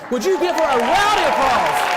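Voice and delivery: hyped crowd call; rallying call. Real, not acted. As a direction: fire up the crowd for applause. Calling crowd to applaud.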